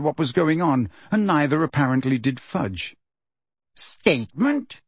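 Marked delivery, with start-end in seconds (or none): 3.8-4.8 slow, puzzled rising question. A narrator's reading. Fudge repeating Dumbledore's word, slow and questioning. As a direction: draw the word out as a higher-pitched query. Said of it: "said Fudge slowly".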